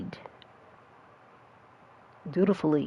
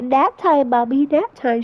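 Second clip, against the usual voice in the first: put-on kid voice; 6 semitones higher than usual. Son voiced asking for nap.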